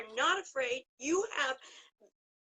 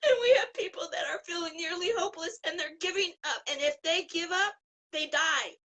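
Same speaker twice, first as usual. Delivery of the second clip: crying out louder, voice breaking; tearful, urgent; 3 semitones higher, 5 dB louder than usual. Real, not acted. Guest pleading for lonely patients to the host, tearful. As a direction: push through the tears with urgency.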